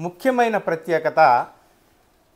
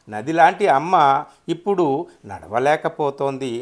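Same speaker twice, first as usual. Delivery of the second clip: measured verse reading with raised, emphatic swells; poetry recitation; 2 semitones higher, 7 dB louder than usual. Acted. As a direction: declaim the poem, louder, phrases falling away at the ends. Recites his own poem.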